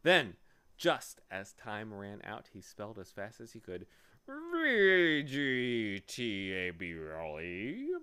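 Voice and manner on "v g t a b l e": slow, swooping bee voice; spelling bee. A narrator's reading. Bee correcting Milo's fear, slow pompous creature voice. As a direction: spell it out with drawn-out, sliding letters.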